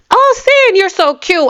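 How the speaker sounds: high, sugary fan voice; imitating a gushing fan. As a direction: coo it like an adoring fan. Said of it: "the people who are saying".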